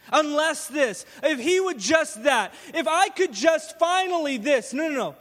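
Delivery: loud, driving sermon climb; full-force preaching. Acted. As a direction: preach it, pressing each phrase harder.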